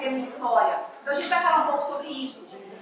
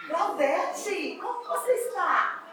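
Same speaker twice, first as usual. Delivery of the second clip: higher-pitched playful question, drawn out; intonation exagérée; 4 semitones higher, 5 dB quieter, much slower than usual. Shown as a demonstration. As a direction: ask it high and stretched, as an intonation game. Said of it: "vou perguntar pra ela com uma entonação".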